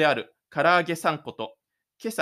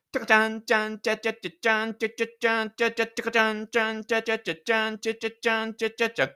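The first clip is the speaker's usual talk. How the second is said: fast, high scat riff; sung transition riff; 6 semitones higher, much faster than usual. Acted. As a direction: scat a quick musical bridge. Scene transition music by mouth.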